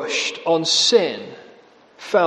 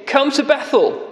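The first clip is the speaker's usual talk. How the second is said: higher, sneering come-along; mocking invitation; 7 semitones higher than usual. Preacher repeating Amos's jeer at Israel, sarcastic. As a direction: sarcastically invite them to Bethel. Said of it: "So he's mocking".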